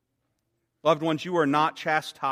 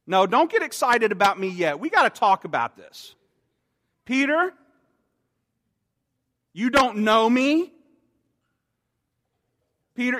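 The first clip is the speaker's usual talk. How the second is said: higher, louder mock scolding of the disciples; a teacher dressing down his class; 5 semitones higher, 4 dB louder than usual. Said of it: "he could have come in a very real way".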